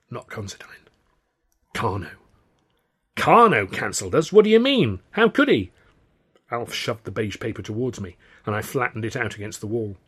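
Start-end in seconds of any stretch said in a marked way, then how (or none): 0.1-2.1 stunned and hushed. Acted. Alf stunned, near silent. 3.1-5.7 high, loud disbelieving cry; shocked outburst. Acted.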